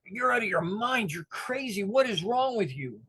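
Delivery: put-on high, indignant yell at the old man; outraged yelling. Young man yelling at the old man who held him under, outraged. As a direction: let him have it, shocked and furious.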